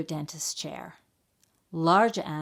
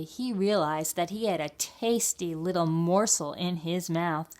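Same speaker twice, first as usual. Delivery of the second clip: usual speaking voice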